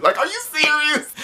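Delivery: can't believe what you saw; incredulous. Real, not acted. Outraged at karate teacher Blaze.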